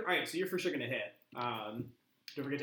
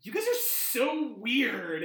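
high, loud, slow exasperation; fed up with these weirdos; exasperated; 9 semitones higher, 5 dB louder, much slower than usual. Acted. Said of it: "she just goes".

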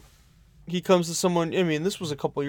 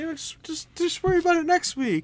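much higher, sugary don't-worry voice; mock sweet dealer; 13 semitones higher than usual.

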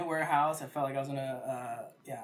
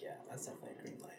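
whispered; 16 dB quieter, much faster than usual. Retold or imitated. Quoting himself, whispered aside.

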